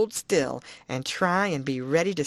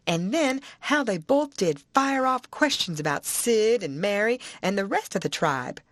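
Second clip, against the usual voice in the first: ordinary delivery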